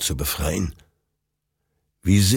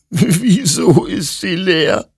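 sobbing; 12 semitones higher, 5 dB louder, slower than usual. Acted. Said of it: "schluchzte Hektor".

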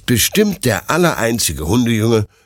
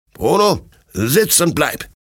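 raised-pitch, firm order to a dog; stern command; 7 semitones higher than usual. A narrator's reading. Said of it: "lauter und strenger Stimme".

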